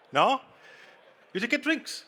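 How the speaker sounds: ordinary talk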